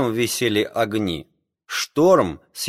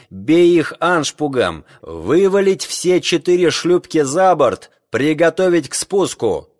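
high, loud urgent orders; shouting over wind; 5 semitones higher, 4 dB louder than usual. Acted. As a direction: bark orders over the gale. Captain orders in the storm.

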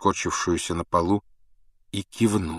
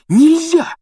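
very high, loud shout; breathless cry; 9 dB louder than usual. A narrator's reading. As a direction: shout it desperately to stop them. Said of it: "задыхаясь". (отчаянный высокий крик)

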